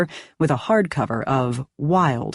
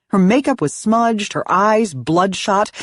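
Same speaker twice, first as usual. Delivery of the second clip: usual speaking voice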